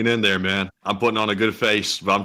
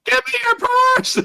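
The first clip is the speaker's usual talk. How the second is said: very high comic shriek; squealed, hammed-up stick-up demand; mock robber scream; 4 dB louder than usual. Imagined robber demanding a purse, screaming high. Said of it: "yelling and screaming like that".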